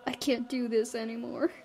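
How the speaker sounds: ordinary talk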